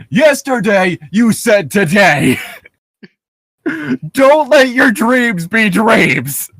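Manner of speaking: loud, slow, hammed-up yelling; imitating a frantic motivational yell; mock motivational shout. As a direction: scream the pep talk like a meme. Quoting Shia LaBeouf motivational rant.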